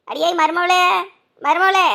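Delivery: loud call across the house. Acted. Mother-in-law yelling for her daughter-in-law, calling out.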